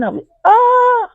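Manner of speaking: high loud cry of alarm breaking off; startled shriek mid-sentence; sudden cry. Acted. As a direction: cry out as if collapsing. Acting out a collapse.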